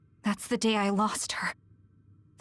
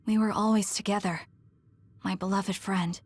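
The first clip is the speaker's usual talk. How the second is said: ordinary talk, nothing marked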